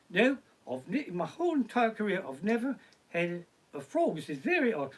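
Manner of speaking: put-on British voice, puzzled by an odd reading; mock British accent. Comic imitates psychic puzzled by a frog message, mock British accent. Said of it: "it's like a British accent".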